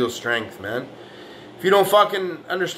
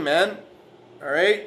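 ordinary delivery

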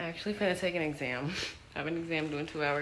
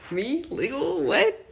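mock disbelief; 8 semitones higher, 5 dB louder, slower than usual. Mock disbelief at turning legal.

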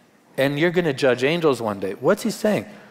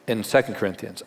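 normal talking voice

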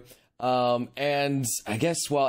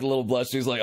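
ordinary talk, nothing marked

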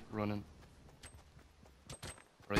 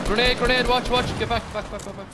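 urgent high shout; grenade warning yell; 12 semitones higher, 11 dB louder than usual. Real, not acted. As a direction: yell the warning, get them back. Grenade incoming, warning teammates.

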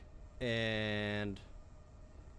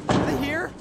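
frightened; 8 dB louder than usual. Real, not acted. Lights flickering, something coming.

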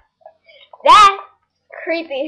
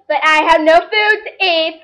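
ordinary talk

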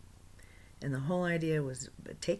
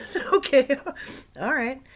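much higher, louder laughing okay; 9 semitones higher, 9 dB louder than usual. Real, not acted. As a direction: laugh it off, overwhelmed. Laughing, overwhelmed.

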